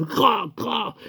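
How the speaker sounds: cartoonish "cra, cra" eating sounds; munching noise. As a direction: mimic crude, noisy chomping. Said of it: "Il a mangé son fromage comme ça".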